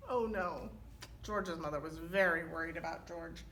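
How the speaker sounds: ordinary delivery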